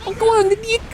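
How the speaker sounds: squeaky falsetto attempt at a Kiwi accent; badly faked New Zealand accent, way up high; comically high mock New Zealand voice; high-pitched New Zealand accent. Shown as a demonstration. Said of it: "why I make it so high-pitched".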